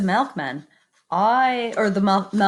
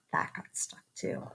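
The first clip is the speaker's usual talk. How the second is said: whisper; 14 dB quieter than usual. A narrator's reading. Hushed storytelling beat.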